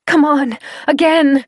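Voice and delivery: high, loud push; girl urging her friend up; urging shout. Acted. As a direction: shout him onto his feet. Urging him to lift.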